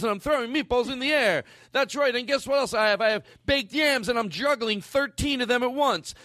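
put-on high, excited old lady rave; grandmother's giddy voice. Telling the family about her wild Thanksgiving, high and giddy. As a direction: gush like a tripping grandma.

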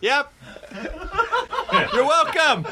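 high-pitched smug reply; mock smug high voice. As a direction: squeak it out smugly, mock proud.

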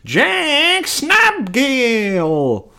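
very high, drawn-out emcee announcement; announcer intro. Retold or imitated. Club emcee introducing the host, high drawn-out announcer voice. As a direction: stretch the name out like a big host intro.

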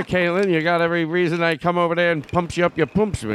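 high 1920s tough guy; hammy gangster threat; old-timey mob voice. Acted. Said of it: "your old-timey crackly voice".

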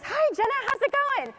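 high-pitched, upbeat phone greeting; President Trump. Retold or imitated. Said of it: "he gets on the phone and he goes".

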